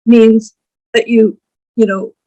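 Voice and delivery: normal talking voice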